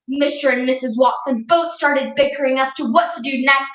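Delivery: ordinary delivery, nothing marked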